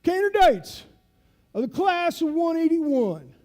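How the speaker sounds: imitated instructor addressing a class, high and loud; instructor's voice. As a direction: call out to the candidates like a veteran drill instructor. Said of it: "he talks like this".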